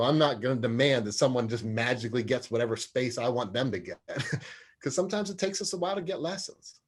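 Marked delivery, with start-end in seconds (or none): none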